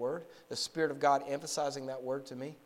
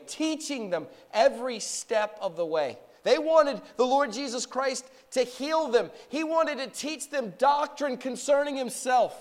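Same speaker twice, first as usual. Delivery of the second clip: building back up into fervent preaching; preacher recounting Jesus' ministry, rising in fervour; 10 semitones higher, 7 dB louder than usual. Acted. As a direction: climb back to the pulpit fire.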